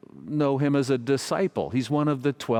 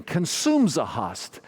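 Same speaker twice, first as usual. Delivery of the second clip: higher, slow imitation of a Croatian accent; imitated Croatian priest, words run together; thick Croatian accent; 4 semitones higher, slower than usual. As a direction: repeat it insistently in a mock Croatian accent. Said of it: "He said it again".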